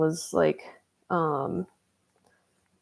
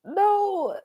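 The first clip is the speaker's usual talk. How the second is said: mock innocent; 7 semitones higher, much slower than usual. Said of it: "I was like".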